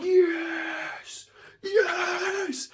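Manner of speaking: high, drawn-out victory yell; triumphant cry. Acted. Bidder celebrating his win, triumphant cry. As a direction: cheer it long and high.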